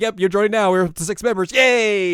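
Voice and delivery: high, loud gleeful announcement; triumphant cheer. Retold or imitated. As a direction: build to a long celebratory yay.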